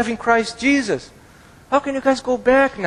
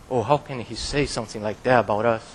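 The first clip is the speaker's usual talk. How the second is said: low, fast, mocking grumble of an offended member; offended churchgoer; 11 semitones lower, 6 dB quieter, faster than usual. Preacher mimicking an offended church member, low fast grumble. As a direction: sneer it like a churchgoer taking offence. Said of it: "and be offended".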